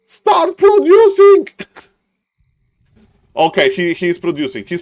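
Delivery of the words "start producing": high, loud pleading yell; exasperated shout. Acted. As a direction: scream at him to spend the gold. Caster begging player to spend.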